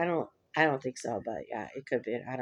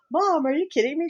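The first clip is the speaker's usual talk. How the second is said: high, louder incredulous cry at mom; retold disbelief; 12 semitones higher, 6 dB louder than usual. Daughter hearing mom's advice, high incredulous cry. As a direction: act out shock at mom's idea.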